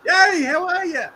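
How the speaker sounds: high, slow, hammed-up cheery hello; cheery greeting. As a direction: wave hello like an overjoyed country driver. Mock cheery country greeting.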